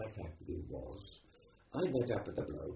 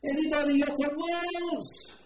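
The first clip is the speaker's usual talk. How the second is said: loud high Welsh call; shouting; 9 dB louder than usual. Retold or imitated. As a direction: call out across the convoy. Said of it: "Welsh voice behind shouting".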